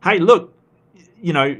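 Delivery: loud protest. Real, not acted. Streamer jokingly defending Ember, loud and high. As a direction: jump in to defend a friend.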